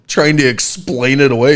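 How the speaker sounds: ordinary talk, nothing marked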